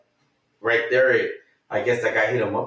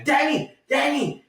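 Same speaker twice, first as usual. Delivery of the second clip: much higher urgent call; calling Danny. Retold or imitated. Running out to tell Danny, high urgent calling. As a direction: call his name twice to get his attention.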